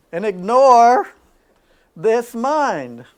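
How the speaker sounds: preacher's ringing call; loud emphasis. Real, not acted.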